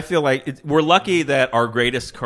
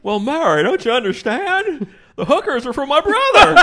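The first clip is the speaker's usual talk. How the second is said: put-on much higher, pleading old-movie voice, rising louder; Jimmy Stewart impression; 13 semitones higher, 5 dB louder than usual. Jimmy Stewart explaining hookers to Mary, high and pleading. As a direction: beg Mary in a classic film star voice. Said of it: "The Jimmy Stewart Porky's".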